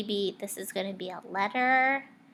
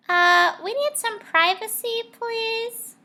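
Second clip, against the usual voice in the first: put-on high, loud kid voice; boy asking politely, voiced high; Eddie's voice; 13 semitones higher, 8 dB louder than usual. A narrator's reading. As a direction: pipe up like an eager student. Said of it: "he said".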